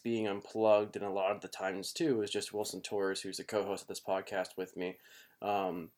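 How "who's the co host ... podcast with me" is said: ordinary talk, nothing marked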